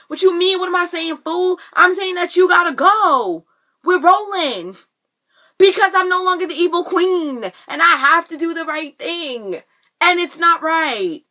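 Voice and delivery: mock-heated protest pitched well up; hammed-up indignation. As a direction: play an exasperated, melodramatic retort. Acts Regina's heated reply to Robin.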